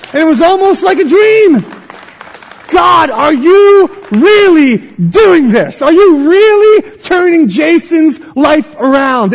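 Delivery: high, loud, stretched-out cry of amazement; impassioned preaching. Acted. Preacher marveling at his cousin's turnaround, impassioned cry. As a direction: cry out to God, drawing out the key words. Preaching climax, crying out to God.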